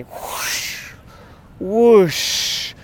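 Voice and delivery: breathy whooshing wave sound; soft imitated surf rush. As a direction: let the wave sweep past, whoosh. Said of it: "like this big wave just washing".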